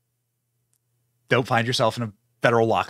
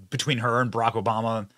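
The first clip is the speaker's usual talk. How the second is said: ordinary talk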